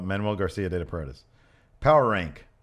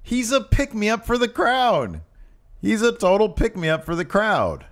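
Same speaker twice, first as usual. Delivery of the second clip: very high, strained mock-argument voice; over-the-top emphatic agreement, voice pushed way up; hammed-up insistence; 12 semitones higher than usual. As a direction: argue it high-pitched, as if outraged to agree.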